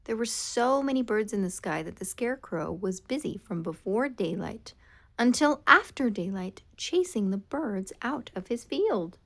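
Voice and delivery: plain reading voice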